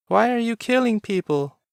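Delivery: put-on high child's voice, bewildered; a small son pleading with his father; frightened little boy's voice. A narrator's reading. Child character speaks.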